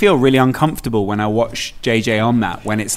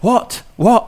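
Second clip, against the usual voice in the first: confused; 8 semitones higher than usual. Retold or imitated. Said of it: "the cleaner's like".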